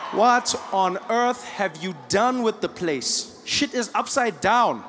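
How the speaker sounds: drawn-out, incredulous delivery; slowly appalled; slow disbelief. Acted. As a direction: space out every word in astonishment. Repeats question in mock disbelief.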